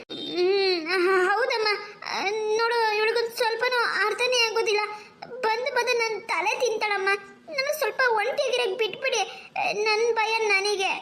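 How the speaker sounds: crying, pitch up, words catching; tearful complaint. Acted. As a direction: sob out the fears to mother. Bride sobbing to her mother.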